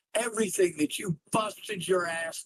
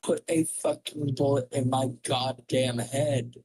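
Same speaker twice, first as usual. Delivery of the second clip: ordinary delivery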